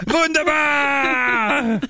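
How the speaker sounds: very high, drawn-out mock yell. Host mimics the commercial's shout, long high yell. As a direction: holler it high and long like the advert. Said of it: "you gotta yell".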